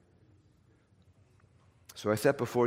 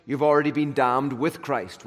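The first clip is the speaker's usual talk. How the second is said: ordinary talk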